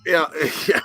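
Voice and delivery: ordinary talk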